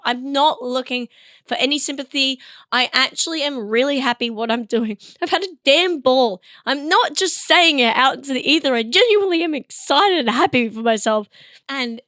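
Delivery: usual speaking voice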